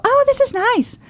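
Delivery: high, swooning mock tourist; high gushing imitation of a charmed lady. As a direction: coo it like a delighted visitor. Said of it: "they found everything so".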